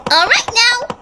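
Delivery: high sweet voice, coldly cheerful; sweet menace; sinister cheer. Acted. Villain tells Fluttershy to brace, sinister cheer.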